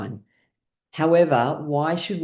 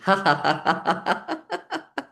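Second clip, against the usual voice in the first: laughing demo; 5 semitones higher than usual. Shown as a demonstration. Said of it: "with a bit of laughing".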